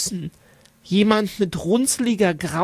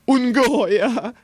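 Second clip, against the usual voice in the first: giggling; 4 semitones higher, 3 dB louder than usual. A narrator's reading. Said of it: "kichert Hotte".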